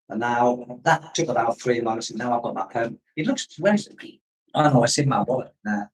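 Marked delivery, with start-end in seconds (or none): none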